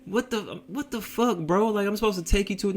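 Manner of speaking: high-pitched incredulous protest; confused disbelief. Retold or imitated. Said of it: "I was more so confused".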